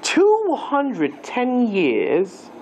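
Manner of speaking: Two hundred ten years very slow in a put-on English accent. Shown as a demonstration. Teacher trying the number in an English accent for the class. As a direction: drawl it out like an Englishman. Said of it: "should I do that in an English accent?".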